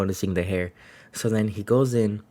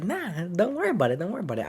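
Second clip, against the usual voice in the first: higher-pitched, lightly imitated "no worries"; light brush-off; 9 semitones higher, faster than usual. Young patient to apologetic doctor, a light brush-off. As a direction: wave off an apology in a raised voice.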